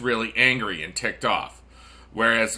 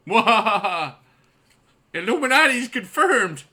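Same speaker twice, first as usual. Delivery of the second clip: mad scientist; 9 semitones higher than usual. Acted. Evil laugh, conspiracy joke.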